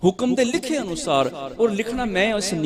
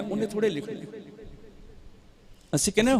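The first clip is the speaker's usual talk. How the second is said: usual speaking voice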